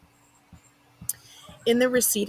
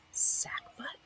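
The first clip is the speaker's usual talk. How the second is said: silent mouthed word, just breath; mouthed whisper; 10 dB quieter than usual. Acted. Football boy mouths the word to his friend, whispered. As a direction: mouth it to your friend, barely audible.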